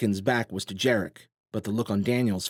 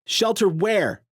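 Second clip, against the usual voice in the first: calling out; 11 semitones higher, 5 dB louder, slower than usual. A narrator's reading. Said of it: "someone called".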